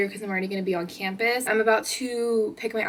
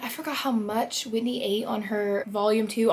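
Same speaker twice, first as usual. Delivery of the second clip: usual speaking voice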